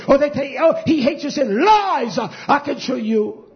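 high, loud pulpit delivery; insistent sermon cry; forceful preaching. Real, not acted. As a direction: hammer it out to the congregation. Preaches on God's hatred.

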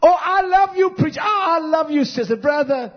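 hammed-up gushing flatterer, loud and high; mock the fake love of a false friend; sweet-talking enemy. Preacher mimicking an enemy flattering the congregation, hammed-up gushing. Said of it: "That's how an enemy speaks".

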